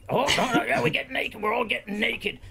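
high, eager imitation of his partner; mock excited partner. Retelling his partner's eagerness, high and eager. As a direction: gush about it like the partner did.

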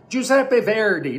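"Giuseppe Verdi" slowly, with an American-style Verdi; American mispronunciation. Retold or imitated. Teacher imitates Americans naming the composer, mispronunciation. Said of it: "Eles falam".

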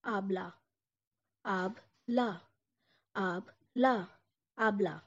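"Ab-la" in two parts, ahb-lah; slow "abla" syllable by syllable; Turkish abla. Shown as a demonstration. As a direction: Turkish for elder sister, spoken clearly. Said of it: "call her abla".

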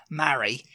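MARRY with a short, open A as in "cat". Shown as a demonstration. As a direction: say MARRY as "ma-ree", A as in "hat". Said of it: "you're pronouncing it a short A".

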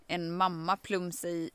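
ordinary talk, nothing marked

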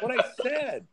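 put-on high, puzzled kid protest. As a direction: protest like a confused boy. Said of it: "I was like".

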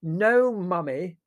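high, exasperated roar; loud, impatient correction. A narrator's reading. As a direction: shout it as if to someone hard of hearing. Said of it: "roared Prue".

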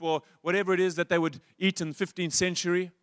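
ordinary talk

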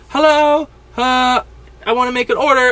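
put-on high-pitched young caller voice; imitated teenage girl. Mock teen caller placing an order, high girlish voice. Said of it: "fourteen-year-old girl that called".

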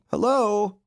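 high, loud call of greeting. A narrator's reading. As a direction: call hello from the street. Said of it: "when a voice called".

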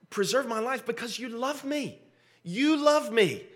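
high-pitched, imploring prayer; heartfelt plea. Acted. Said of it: "what David is saying here is".

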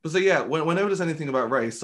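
ordinary delivery

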